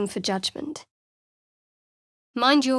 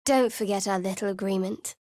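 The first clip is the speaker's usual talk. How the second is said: ordinary talk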